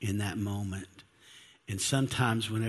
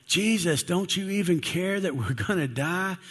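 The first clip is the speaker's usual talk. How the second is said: high-pitched, acted panicky plea; terrified outcry; 6 semitones higher than usual. Terrified disciples wake Jesus on the boat, pleading in panic. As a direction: cry out like a scared disciple. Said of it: "they're all terrified and they're scared".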